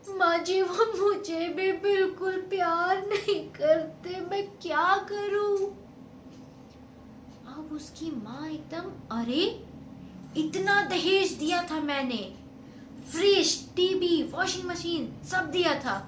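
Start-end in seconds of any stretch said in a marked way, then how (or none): 0.1-5.6 loud, high, slow pleading wail; tearful plea. Acted. Crying daughter pleads to mother. 9.1-16.0 loud, indignant defence; loud protest. Acted. Mother defends the dowry.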